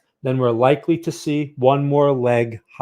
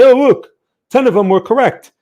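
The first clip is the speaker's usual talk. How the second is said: higher, hammed-up bragging; mock boasting; 7 semitones higher, 5 dB louder than usual. Imagined trader bragging about a win rate, mock boastful. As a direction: play a seller showing off results. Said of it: "and say".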